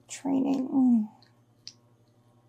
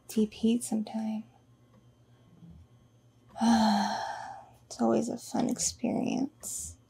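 whispering. Acted. Intimate whispered confession.

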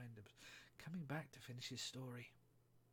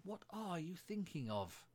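high, slow, indignant question; drawn-out scolding from a severe mouse; 7 semitones higher, 3 dB louder, slower than usual. A narrator's reading. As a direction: demand it sternly, pitch lifted. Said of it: "said the mouse to Alice severely".

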